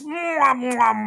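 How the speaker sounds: smack big cartoon kisses; kissing noises. Acting out kiss sounds.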